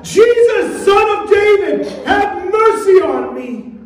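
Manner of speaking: high, urgent shout to Jesus; crying out. Shown as a demonstration. Preacher showing church how to cry out, desperate shout. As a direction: cry it out, stretching the plea for mercy. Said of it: "are you crying out to Jesus like that".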